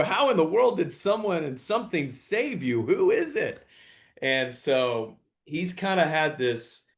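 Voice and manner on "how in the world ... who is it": high-pitched incredulous imitation; imagined amazed question. Imagined strangers asking his son how he survived, amazed. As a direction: play an astonished onlooker, voice leaping up.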